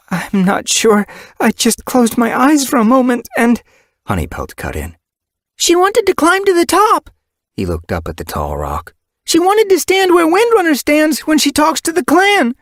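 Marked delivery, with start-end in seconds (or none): 0.1-3.6 flustered she-cat. Acted. Sick cat Slate answers. 5.6-12.6 put-on high, loud child kit voice; eager tom kit, tattling; young kit voice. Kit Honeypelt speaks.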